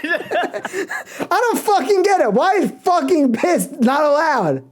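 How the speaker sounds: high, laughing mock complaint; laughing rant. Real, not acted. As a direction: protest through laughter. Laughing rant about curse words.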